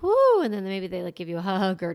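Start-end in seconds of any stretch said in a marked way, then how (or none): 0.0-0.4 high, loud shaky exhale of relief; loud woo. Retold or imitated. Teller shaking off the coyote scare, high loud woo. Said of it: "And they're like".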